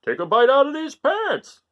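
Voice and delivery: loud high urging; Father Goat urging. Acted. Father urging his son to eat pants, loud. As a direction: push him loudly to bite. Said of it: "said Father Goat".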